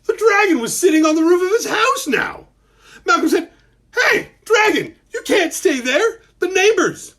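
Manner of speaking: high, loud frantic pleading; a boy begging a dragon to get off the roof; panicked boy. Acted. Panicked boy shouting up at the dragon on the roof.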